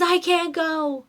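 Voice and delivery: put-on slow, high whiny kid voice; whiny son. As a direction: drag it out like a tired child. Son refusing to get up.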